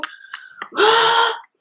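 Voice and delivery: big, high, loud gasp; mock shocked gasp. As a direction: gasp sharply, imitating panicked realisation. Acting partner's shocked reaction.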